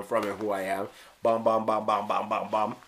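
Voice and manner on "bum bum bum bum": ordinary delivery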